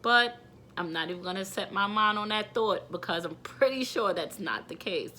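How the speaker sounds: ordinary delivery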